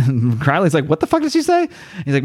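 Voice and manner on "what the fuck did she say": high-pitched baffled question; mock bewilderment. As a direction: squeak it out, totally baffled. Acted startled question from Crowley.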